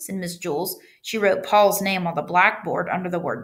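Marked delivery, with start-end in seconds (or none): none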